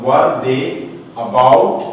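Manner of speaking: word by word again, paused; teacher rereading the question; slow reread. Shown as a demonstration. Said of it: "फिर से पढ़कर सुना रहा हूं".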